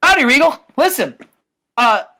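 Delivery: high, loud mock greeting; put-on cocky hail to a rival; Lodi. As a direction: burst in with a hammed-up howdy. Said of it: "He says".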